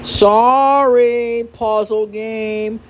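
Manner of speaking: high, drawn-out sorry; exaggerated sing-song apology; mock apology. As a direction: apologize to a game in a high put-on tone. Mock apology to the puzzle game.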